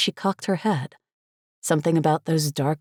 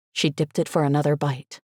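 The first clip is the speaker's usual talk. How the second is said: ordinary delivery, nothing marked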